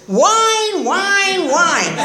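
high, drawn-out jeer, falling each time; mocking whine. [name] mocking her two years of complaints, whining. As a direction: whine each word in mockery.

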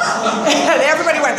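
usual speaking voice

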